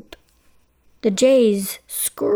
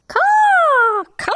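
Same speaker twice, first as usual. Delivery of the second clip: very high, loud crow cry; a crow's shrill caw; 5 dB louder than usual. Acted. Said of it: "The crows cawed".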